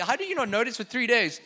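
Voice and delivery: ordinary delivery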